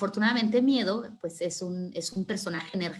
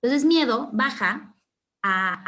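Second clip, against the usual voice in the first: usual speaking voice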